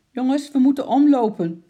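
put-on loud, high urgent shout to children; calling out. A narrator's reading. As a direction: shout a change of route to the group. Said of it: "riep de moeder". (luid roepend)

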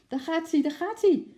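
high, loud excited calls; crowd shouting. A narrator's reading. People calling as the ape runs off, high excited shout. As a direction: shout after a runaway. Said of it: "riepen de mensen".

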